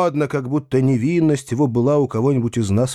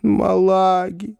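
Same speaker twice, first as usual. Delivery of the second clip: slow, higher-pitched moan; 7 semitones higher, slower than usual. A narrator's reading. Foma, eyes closing, asks for Malaga, moaning. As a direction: groan the request out weakly, stretching the words. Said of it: "простонал Фома".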